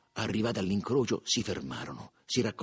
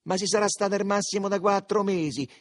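defiant, raised-voice rebuttal; sfida; 9 semitones higher, 7 dB louder than usual. A narrator's reading. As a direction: contest it loudly, pitched high. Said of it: "in aria di sfida". (ribattere con aria di sfida)

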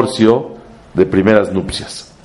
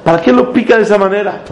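much higher, rising indignant questions; incredulous challenge; 11 semitones higher, faster than usual. Real, not acted. Rabbi asking his class why Jacob provokes, incredulous. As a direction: press the point, how dare he provoke.

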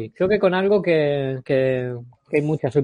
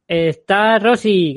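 slow announcer reveal; 3 semitones higher, 3 dB louder, much slower than usual. Acted. Drawn-out introduction.

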